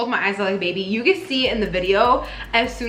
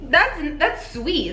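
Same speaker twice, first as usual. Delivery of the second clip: usual speaking voice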